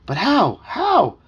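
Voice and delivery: loud, high, climbing plea; desperate questioning. A narrator's reading. Boy desperately asks his dog for a plan, high plea.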